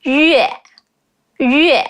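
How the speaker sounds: 月 said distinctly with rounded "yu" into "eh"; careful 月 sound. Shown as a demonstration. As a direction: model 月 slowly, lips rounded at the start. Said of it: "there's a really tricky sound in it".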